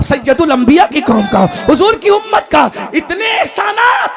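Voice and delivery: rising preacher's roar; preaching at full force. Acted. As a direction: thunder it at the crowd, building. Fiery sermon building up.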